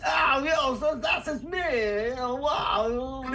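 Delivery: high, drawn-out contorted character voice; distorted-face voice. Shown as a demonstration. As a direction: stretch it through a twisted face. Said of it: "you can even distort your s-, your face".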